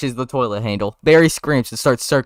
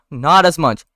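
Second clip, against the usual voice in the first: ordinary delivery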